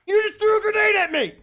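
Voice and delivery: high, loud outraged yell; outraged shout. Real, not acted. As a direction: yell in disbelief. Grenade thrown at him.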